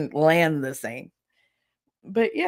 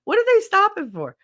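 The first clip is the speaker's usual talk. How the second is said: high, loud baffled voice; watching the squirrel, totally confused; puzzled onlooker; 5 dB louder than usual. Retold or imitated. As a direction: ask it squeaky and baffled.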